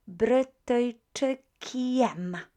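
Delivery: Brytyjczykiem, each syllable stretched very slowly; very slow model. Shown as a demonstration. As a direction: spell out Brytyjczykiem with the softened kie. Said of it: "soften ... this letter by using I".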